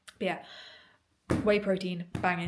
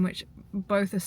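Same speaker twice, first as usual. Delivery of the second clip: ordinary talk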